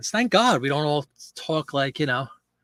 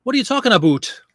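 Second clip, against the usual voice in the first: higher mock-Canadian, "about" as "a-boot"; playful Canadian accent with an oo in "about"; 7 semitones higher, 4 dB louder than usual. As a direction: imitate a Canadian saying "aboot", pitched up. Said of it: "she absolutely does the about".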